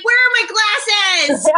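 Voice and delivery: mock frantic search. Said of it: "Joanna's like".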